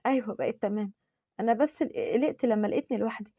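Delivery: hushed voice of someone recovering; weak, subdued. Acted. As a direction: answer quietly, still feeling poorly. Injured woman answers weakly.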